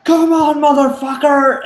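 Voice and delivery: high, loud taunt in imitation; shouted challenge. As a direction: yell it like a movie soldier daring a monster. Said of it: "someone will start to challenge the Predator".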